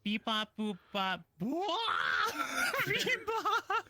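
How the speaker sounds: slow, loud beeping, laughing; alien voice. Pretend. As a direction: beep like a cute alien. Bunny avatar robot beeps.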